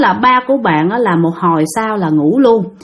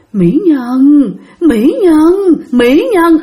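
lightly voiced ghostly man calling her, pitched up; creepy ghost man; 5 semitones higher, 3 dB louder, much slower than usual. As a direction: imitate an eerie male spirit's call. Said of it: "cái giọng nói thấy ghê lắm các bạn, nó ồn ồn".

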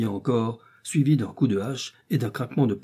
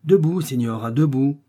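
raised, higher-pitched order; brisk command; 4 semitones higher, 6 dB louder than usual. A narrator's reading. Man rousing a captive signora, brisk command. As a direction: order the captive to get up, firmly.

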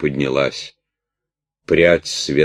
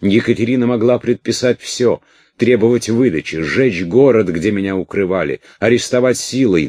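furious; 7 semitones higher, 6 dB louder than usual. A narrator's reading. Prisoner rages at Orlov.

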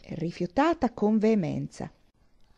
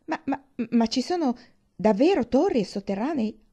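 high, eager question with a stumbled start; stammering excitement; 5 semitones higher, 4 dB louder than usual. Acted. Catherine asking about towers and dungeons, stammering with excitement. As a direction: trip over the words in wonder. Heroine thrilled about the castle.

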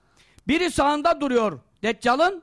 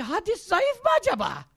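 high-pitched mock questioning; mock-doubtful query, voice lifted high; mocking the doubter; 8 semitones higher than usual. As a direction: imitate a silly sceptic, pitched high. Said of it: "Hoca bir şey anlatıyor. Diyor ki".

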